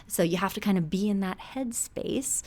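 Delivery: normal talking voice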